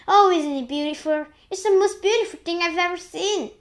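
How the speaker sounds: high, loud wonder; awed delight. A narrator's reading. James spellbound by the peach, awed delight.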